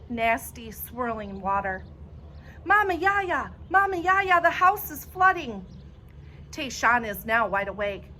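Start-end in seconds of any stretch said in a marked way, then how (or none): 2.6-5.6 high, loud panicked calling; shouting in terror; panicked scream. A narrator's reading. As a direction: scream for grandma as the water rises. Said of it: "I look down the stairwell and scream".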